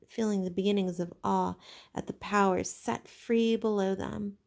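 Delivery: ordinary delivery, nothing marked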